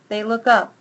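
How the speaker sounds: plain reading voice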